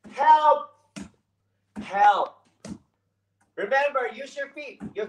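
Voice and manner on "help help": high, loud cry for help; scared scream for help. Shown as a demonstration. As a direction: yell help like you're in danger. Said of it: "we're gonna go scream".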